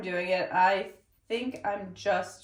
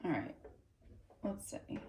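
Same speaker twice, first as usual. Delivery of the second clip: whispered; 3 semitones lower, 13 dB quieter than usual. Real, not acted. Muttering while wrapping fabric.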